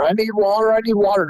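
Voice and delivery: mock yell. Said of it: "he starts yelling at my mom".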